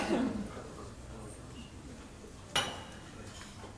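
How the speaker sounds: quiet trailing laugh; gentle amused laugh dying away; soft breathy laugh. Real, not acted. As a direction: let a soft laugh fade out. Laughs after the song title.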